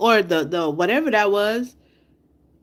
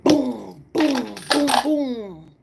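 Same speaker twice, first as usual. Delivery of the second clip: spoken explosion sounds, each one falling away; imitated airstrike booms; 4 semitones higher, 3 dB louder, much slower than usual. Showing the airstrike hit the kid's home, mock explosions. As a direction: punch out each boom, then let it drop.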